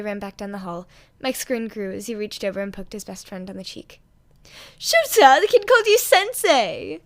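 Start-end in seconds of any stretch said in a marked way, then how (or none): 4.8-7.0 high, loud, drawn-out delight; excited teasing; gleeful tease. Acted. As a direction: crow it at your friend. Mike teasing his friend.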